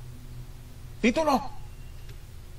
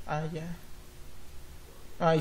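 usual speaking voice

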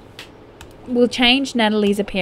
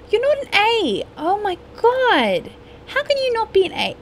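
scolding parent; 12 semitones higher than usual. Pretend. Scolding the game's kids.

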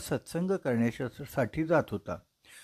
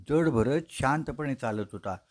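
plain reading voice

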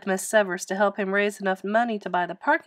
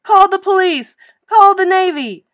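high, loud cries for help; panicked shriek; 11 semitones higher, 13 dB louder than usual. A narrator's reading. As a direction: shout orders in hysterical alarm. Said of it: "shrieked the coach".

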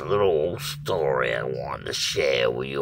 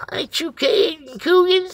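high, raised-voice call to someone recognized; retold hailing; 6 dB louder than usual. Stranger asks the storyteller if it's him, hailing loudly. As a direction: imitate an excited passerby calling out.

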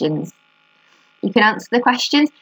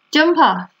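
much higher, lightly called command; mild calling out; 8 semitones higher than usual. A narrator's reading. Fern wants her turn on the swing, mild call. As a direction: call it high without really shouting.

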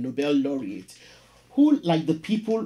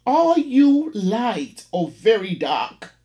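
slow, high and louder landlady voice; prim landlady; 6 semitones higher, 6 dB louder, slower than usual. Acted. Landlady pressing the caller about his skin, slow and piping. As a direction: draw each word out like a prim landlady. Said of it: "lipstick coated ... piped".

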